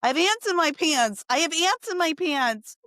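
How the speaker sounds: high-pitched mock yell, not full volume; imitating a child's yelled alarm; retold panicked yell. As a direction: cry it out like a frantic kid, held back. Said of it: "couldn't think of anything else to yell".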